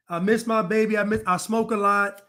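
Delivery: ordinary delivery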